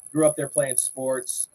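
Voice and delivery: ordinary talk, nothing marked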